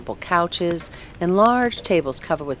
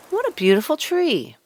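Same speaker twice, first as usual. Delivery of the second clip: higher, delighted exclamation; admiring passer-by; 10 semitones higher than usual. Acted. Passers-by admiring the fir tree, delighted. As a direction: marvel at the tree.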